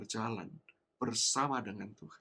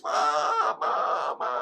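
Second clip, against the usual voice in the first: crying shout; 7 dB louder, much slower than usual. Retold or imitated. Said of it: "Saya cuma teriak, nangis".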